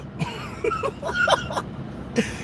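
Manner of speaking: squealing high laugh. Real, not acted. Comic cracking up at his own punchline. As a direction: burst out laughing.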